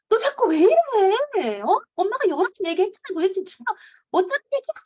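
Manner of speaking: high, loud exasperated scolding; imitating an angry mom. Mom losing it at a misbehaving child, loud scolding. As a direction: act out a mother snapping at her kid. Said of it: "이제 화를 내죠".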